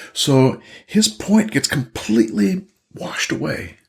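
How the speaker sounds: normal talking voice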